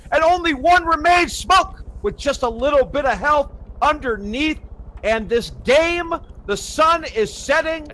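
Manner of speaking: high, building esports commentary; excited play-by-play. Real, not acted. Caster calls the final round to viewers, excited play-by-play. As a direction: call the clutch at full pitch, stretching key words. Live play-by-play at match climax.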